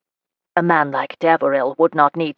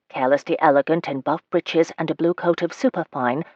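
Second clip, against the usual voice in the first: usual speaking voice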